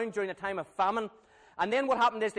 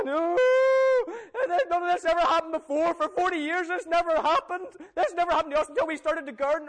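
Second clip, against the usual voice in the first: higher, louder anguished wail; crying out in despair; 9 semitones higher, 6 dB louder than usual. Acted. Israelites crying out in the street after snakebites, loud and anguished. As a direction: cry out as if the plague just hit your house.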